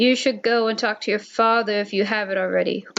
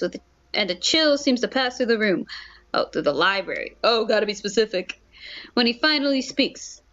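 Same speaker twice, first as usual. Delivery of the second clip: ordinary talk, nothing marked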